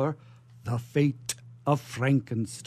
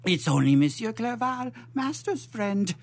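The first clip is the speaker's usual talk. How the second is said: much higher, trembling-voiced frightened servant; nervous servant; 7 semitones higher than usual. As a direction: squeak it out like a jumpy servant. Fritz answers.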